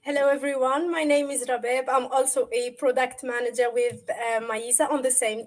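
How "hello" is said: normal talking voice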